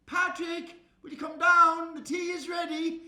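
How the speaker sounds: high, loud call up the stairs. Acted. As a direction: call the child down to tea. Mother calls child down to tea.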